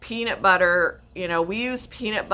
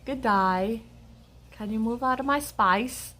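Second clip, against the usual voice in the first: phonetically faked Aussie accent; mock Australian accent; 4 semitones higher, 3 dB quieter than usual. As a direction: play at being Australian. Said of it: "pronounce it phonetically".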